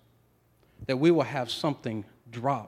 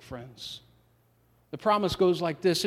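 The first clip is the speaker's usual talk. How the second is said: ordinary talk, nothing marked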